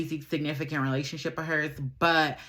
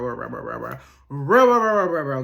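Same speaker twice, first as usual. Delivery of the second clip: stack the reals, climbing louder; emphatic repetition; 2 semitones lower, 5 dB louder than usual. Real, not acted.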